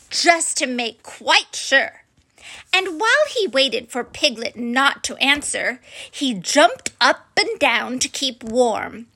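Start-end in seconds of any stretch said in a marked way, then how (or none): none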